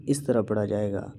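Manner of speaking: ordinary talk, nothing marked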